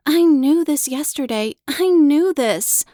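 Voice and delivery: frustrated outburst. Acted. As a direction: burst out in frustration.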